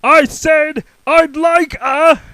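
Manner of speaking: slow loud high shout; top of his voice. Acted. Mister Noisy shouting at the butcher, then stopping. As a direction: bellow and break off. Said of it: "at the very top of his voice".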